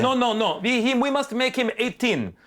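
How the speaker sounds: high, hammed-up German accent delivering notes; mock German accent. German director giving script notes, mock German accent. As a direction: bluntly lay down demands in a thick German accent. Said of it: "directors sound like Hans and Franz".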